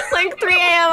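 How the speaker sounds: ordinary delivery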